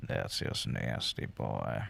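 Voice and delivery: low unvoiced gruff growl; gruff whisper. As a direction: rasp it out like a tough guy. Joking reaction.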